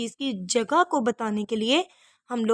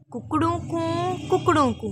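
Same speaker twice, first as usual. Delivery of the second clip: rooster crow; 6 semitones higher than usual. A narrator's reading. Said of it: "मुर्गा चिल्लाया".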